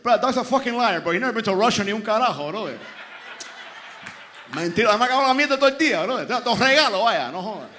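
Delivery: loud, fast, fed-up tirade; exasperated rant. Acted. Seller says dog's a liar, exasperated rant. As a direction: rattle off the complaint, loudly. Seller rants that dog lies.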